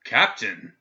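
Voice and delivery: sharp loud Captain; shouting back. A narrator's reading. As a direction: snap back Captain loudly. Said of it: "the governor shouted back".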